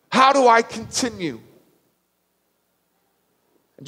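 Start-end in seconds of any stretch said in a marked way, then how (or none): 0.1-1.4 high, pleading cry; urgent, overwhelmed question; desperate questioning. Retold or imitated. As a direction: plead for a way forward. Voicing Thomas's desperate question.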